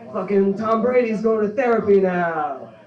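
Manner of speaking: mock New Jersey mob boss rant; Tony Soprano. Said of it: "What would Tony Soprano say here?".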